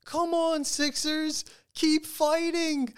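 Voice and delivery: high-pitched, loud imitation of a kid screaming; retold kid's scream. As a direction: yell it high like a little kid cheering his team on. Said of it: "he's just screaming".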